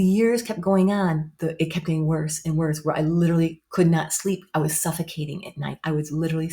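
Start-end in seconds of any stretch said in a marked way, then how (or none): none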